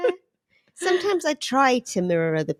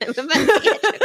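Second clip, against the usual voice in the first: laughing; 10 semitones higher, 4 dB louder than usual. Real, not acted. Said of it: "[name] repeating it".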